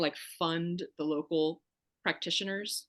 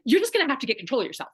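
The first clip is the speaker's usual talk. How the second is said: loud, higher, fast harsh scolding; stern scolding; 5 semitones higher, 7 dB louder, faster than usual. Retold or imitated. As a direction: bark it at her like an annoyed practitioner. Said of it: "she was like".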